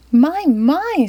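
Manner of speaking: put-on high, fussy old lady; lightly voiced fussy lady; Missus Fitzwizzle. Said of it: "said Missus Fitzwizzle".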